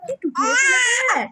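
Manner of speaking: high-pitched mock amazement; mock-frightened aunt. Teasing baby with aunt's reaction, mock alarm. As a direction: exclaim like a playfully alarmed relative. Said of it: "বলবে".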